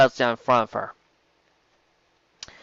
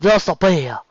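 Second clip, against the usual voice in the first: forced gruff voice; 5 semitones higher, 4 dB louder, slower than usual. Acted. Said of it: "forced his usual speaking tone down an octave and added just a bit of grittiness".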